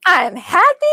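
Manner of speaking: high, gasping burst of joy; overjoyed gasp. Retold or imitated. Medium retelling the uncle's joy, high gasping delight. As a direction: gasp then beam out I'm happy.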